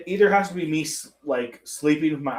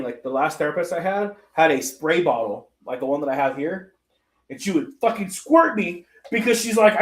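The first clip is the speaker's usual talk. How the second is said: ordinary delivery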